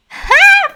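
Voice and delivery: sudden shrieking laugh burst; loud high laugh. Real, not acted. Laughing at big rabbits.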